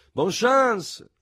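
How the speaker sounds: high, loud cry of good luck; shouted send-off. A narrator's reading. Café veterans shouting good luck after the three, loud and high. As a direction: yell it after them. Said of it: "seguiti da grida di".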